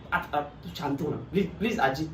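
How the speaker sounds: low, soft coaxing; soothing plea. Acted. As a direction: gently beg her to calm down, voice dropped. Soothes crying grandmother, pleading.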